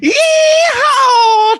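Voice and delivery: high, loud cowboy whoop; yeehaw. Pretend. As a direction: whoop it like a rodeo rider. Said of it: "I was gonna do a yeehaw".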